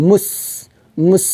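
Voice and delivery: মুস ending in a long, airy s; breath kept flowing. Shown as a demonstration. Teacher showing the whispery letter, মুস with a prolonged hissing s. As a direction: let the air run on through the s of মুস. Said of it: "বাতাসটা চালু রেখে উচ্চারণটা করব".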